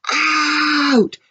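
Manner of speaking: high, loud shout of "Out!"; the baker throws him out with a shout. A narrator's reading. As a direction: bark the word out at him. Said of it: "shouted the baker".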